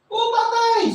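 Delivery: high, eager yes to father; pretending son. Jacob pretends to be Esau.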